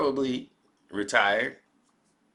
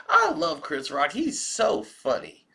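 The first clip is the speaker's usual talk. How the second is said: mock adoring fans; 5 semitones higher, 4 dB louder than usual. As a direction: gush high and slow in mock adoration.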